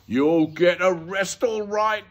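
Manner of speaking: loud, high, drawn-out shout; booming, triumphant retort; shouted threat. A narrator's reading. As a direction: call it out with relish. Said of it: "shouted Mister Dart".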